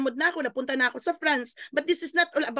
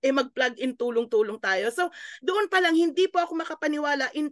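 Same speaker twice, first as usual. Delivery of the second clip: ordinary delivery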